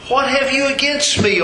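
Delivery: high, railing cry at the prophet; grieving mother's rage. Retold or imitated. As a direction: hurl the accusation up high. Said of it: "in anger she rails against Elijah".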